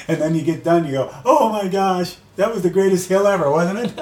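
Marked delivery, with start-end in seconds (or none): 1.2-3.9 high, beaming, a laugh at the end; gushing delight. Retold or imitated. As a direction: gush about the hill you just survived. Gushing after finishing the hill.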